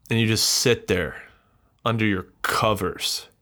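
normal talking voice

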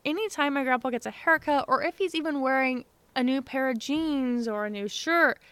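ordinary talk, nothing marked

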